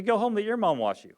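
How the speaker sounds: put-on a parent sending another family's child home; dismissive brush-off. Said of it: "you say".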